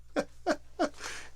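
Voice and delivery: high, voiceless giggling; laughing. Real, not acted. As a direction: burst of real laughter at oneself. Laughs at own rant.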